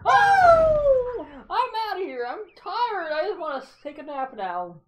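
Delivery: character voice. Acted. Voicing tired game character.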